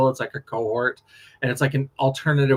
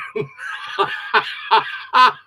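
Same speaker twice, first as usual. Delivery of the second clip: high breathless laugh; 8 semitones higher than usual. Real, not acted. Laughing at her own bad score.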